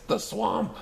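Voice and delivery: high horrified cry; dismayed cry. Acted. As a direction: kids gasping in fright.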